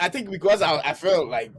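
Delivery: normal talking voice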